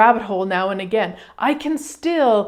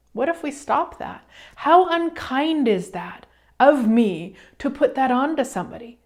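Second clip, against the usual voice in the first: ordinary delivery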